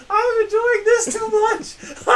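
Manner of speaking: high, loud squeal through laughter; nearly crying with laughter, drawn out; laughing delight. Real, not acted. Listener delighting in friend's humiliation.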